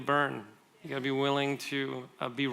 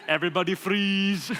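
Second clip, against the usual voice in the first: shouted mock Austrian accent; higher, loud Austrian-accented movie command; Arnold Schwarzenegger; 4 semitones higher, 5 dB louder than usual. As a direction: bellow it like an Austrian action hero. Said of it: "think about some of the movies he's been in".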